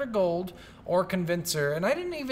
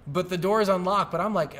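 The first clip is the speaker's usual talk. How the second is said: usual speaking voice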